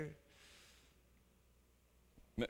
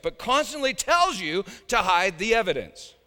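loud, high fiery sermon; impassioned preaching; 8 semitones higher, 10 dB louder than usual. Real, not acted. Preacher exposing the devil's lies to his flock, fiery and loud. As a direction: rail against it at full force.

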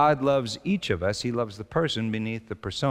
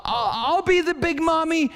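put-on high small child's voice; little daughter's voice; 14 semitones higher, 3 dB louder than usual. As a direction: play the three-year-old, sweet and bossy. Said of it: "she said".

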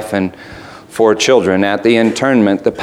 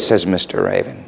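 ordinary delivery

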